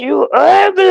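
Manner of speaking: high mimic of the insult; mock insult. Streamer retelling a live host's jab, high mock quote. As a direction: squeal it out in fun. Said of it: "He kept saying".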